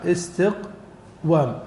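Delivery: "استقوام" slowly, pausing after the silent-vowel qaf; syllable by syllable. Shown as a demonstration. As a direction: split "استقوام" as istiq, then wam. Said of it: "ساكنة والواو مفتوحة".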